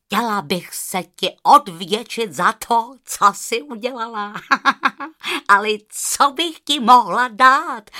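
loud goose voice with cackles; honking goose. A narrator's reading. Goose offers the girl a reward, honking. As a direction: talk like a delighted honking bird.

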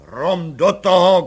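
loud, slow shout; commissioner shouts. A narrator's reading. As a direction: bark the order. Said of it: "křikl komisař".

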